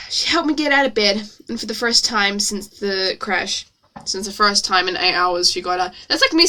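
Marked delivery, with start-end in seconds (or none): none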